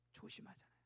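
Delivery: whispered echo of 조심하잖아요. Shown as a demonstration. Preacher echoing to congregation, whispered. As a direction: breathe 조심하잖아요 as a hushed aside.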